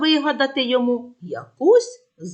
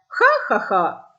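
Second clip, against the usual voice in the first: mocking laugh; slower than usual. Boy mocks fooled shepherds.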